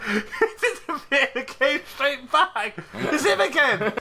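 high, helpless laughter through the words; laughing. Real, not acted. Friend amazed the same man came straight back, laughing. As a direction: squeal it out while laughing.

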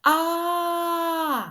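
sobbing A sound; crying A. Shown as a demonstration. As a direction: cry out an open A like a weeping child. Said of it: "Давайте разом".

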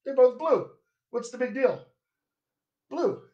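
a confused brain that hears no difference; student's brain shrugging. Retold or imitated. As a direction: toss it off high and careless. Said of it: "the student's brain goes".

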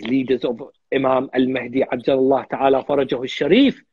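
normal talking voice

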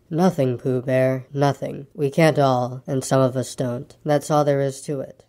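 low, slow, resigned donkey; gloomy Eeyore. Acted. Eeyore brushes off Pooh's concern, low and resigned. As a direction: trudge through it low and mournful.

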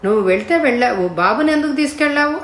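fast, rising to a high, loud yell; loud scolding shout. A narrator's reading. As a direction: yell at him for taking the boy along. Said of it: "అంటూ గట్టిగా కేకలేసింది".

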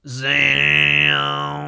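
loud zam blast; zam sound. A narrator's reading. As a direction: shout the blast-off. Machine fires.

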